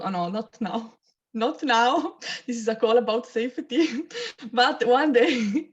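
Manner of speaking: normal talking voice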